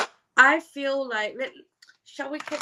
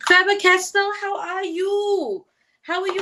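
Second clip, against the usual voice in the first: excited greeting; 9 semitones higher, 7 dB louder than usual. Real, not acted. Host greeting a viewer joining the stream, excited. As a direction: call out to a friend, stretching the end.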